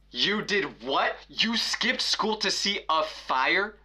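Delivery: imitated outraged mother, drawn out then high-pitched; mock-shrieking parent, slow build to high pitch; mock yelling mom. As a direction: scold in disbelief, pitch climbing without real volume. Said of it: "then she starts yelling".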